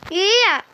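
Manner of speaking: short high yelp of annoyance; sudden high frustrated shout. Real, not acted. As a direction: let out a sharp cry. Frustrated gamer outburst.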